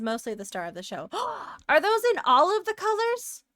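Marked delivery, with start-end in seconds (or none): 1.1-1.6 delighted gasp. Real, not acted. Delighted by viewer's flag. 1.7-3.4 high, excited question; delighted squeal. Real, not acted. Delighted by viewer's flag.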